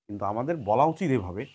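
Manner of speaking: ordinary talk, nothing marked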